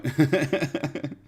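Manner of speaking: high real chuckle; laughing. Real, not acted. Streamer explaining the dog hair on the couch, laughing. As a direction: giggle at your own explanation.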